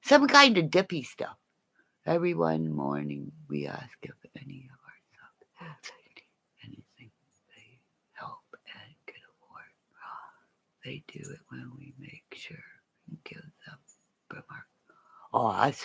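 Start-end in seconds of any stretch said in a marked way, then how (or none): 2.1-13.8 hushed unvoiced whisper; barely breathing the words. Real, not acted. Whispering to viewers about school subs.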